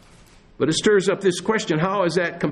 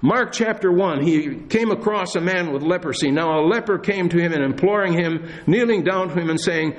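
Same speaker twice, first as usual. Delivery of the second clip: usual speaking voice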